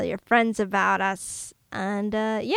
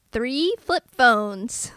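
slow emphatic; 2 semitones higher, 4 dB louder, much slower than usual. Real, not acted. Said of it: "deliberate rating announcement".

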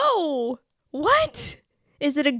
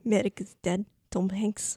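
lower, mock Russian accent; 4 semitones lower, 6 dB quieter than usual. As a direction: echo in a put-on Russian accent. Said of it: "do the Russian accent".

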